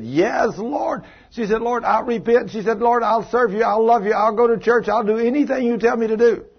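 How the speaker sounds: loud, high, begging cry to the Lord; desperate pleading cry. Retold or imitated. Woman begging God for healing, loud pleading cry. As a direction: wail it out, promising anything.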